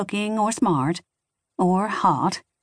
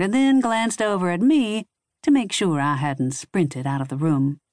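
plain reading voice

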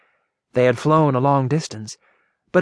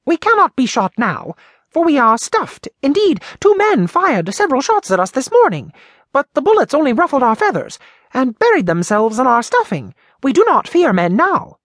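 high, louder cocky bird; boasting jay; 12 semitones higher, 4 dB louder than usual. Acted. Blue jay to Popopo, high cocky boast. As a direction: brag that the bullets can't hurt you.

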